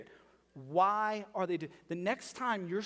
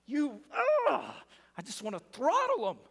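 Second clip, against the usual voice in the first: exasperated rant; 8 semitones higher, 3 dB louder than usual. Retold or imitated. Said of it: "That's like my response".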